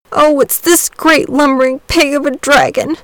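put-on high, mildly tearful boy's complaint; half-sobbed child's protest; sobbing boy. A narrator's reading. Boy complaining to Saint George, mild sob. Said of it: "sobbed the boy".

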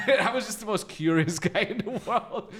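laughing through the line, high. Real, not acted. Recalling being a nosy drunk at a concert, laughing. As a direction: chuckle it out at yourself.

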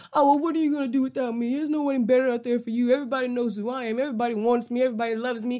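a hint of a smug partner, rattled off fast; lightly mock the self-important ex, quick and breezy; smug manipulator.

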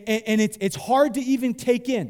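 ordinary talk

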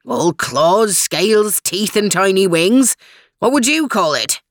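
Scottish brogue, listing item by item; indignant Scot; indignant retort. A narrator's reading. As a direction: throw it back in a thick Scots burr. Scottish dog answers back in character.

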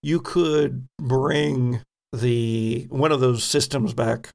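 ordinary delivery

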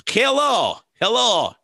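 showing off a practiced Russian accent, half joking; mock Russian accent. Shown as a demonstration. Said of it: "I've been practicing my Russia".

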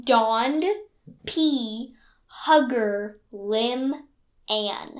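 slow, separated words with pauses. Shown as a demonstration. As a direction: say each word on its own. Repeats puzzle word by word.

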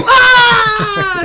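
long, high, loud mock scream; falling scream. Thrown off into the gully, long hammed-up scream. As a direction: wail as if hurled into a gorge.

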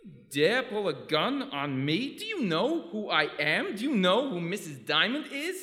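outraged. Acted. Legs outraged at the gun.

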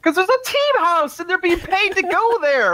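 high, drawn-out exasperated outburst; exasperated retort. Real, not acted. Panelist answering rival in a region argument, exasperated retort.

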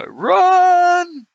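very high, loud drawn-out yell; high-pitched holler to flee; yelled warning. Acted. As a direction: yell it out long and high to the party. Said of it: "maybe I should yell".

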